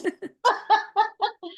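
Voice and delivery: real high, loud burst of laughter; hearty laugh. Real, not acted. Host and guest wrapping up the show, hearty laughter.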